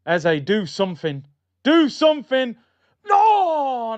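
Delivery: building from loud urging to high yell; desperate fan shout. Real, not acted. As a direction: plead with the player, ending in a high cry. Pleading with player during attack.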